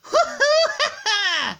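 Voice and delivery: very high, loud cackling laugh; villain laugh. Acted. Character laughs after dialogue.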